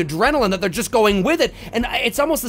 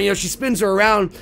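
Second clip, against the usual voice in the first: usual speaking voice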